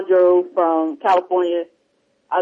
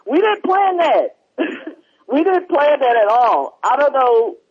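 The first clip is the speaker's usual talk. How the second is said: laughing; 5 semitones higher, slower than usual. Real, not acted. Laughing denial of planning the joke.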